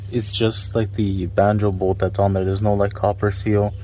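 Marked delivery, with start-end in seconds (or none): none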